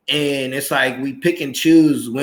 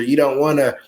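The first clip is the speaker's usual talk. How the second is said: ordinary delivery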